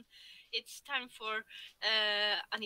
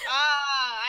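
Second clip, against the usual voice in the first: frustrated shout; 6 dB louder than usual. Real, not acted. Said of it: "I hate being left out".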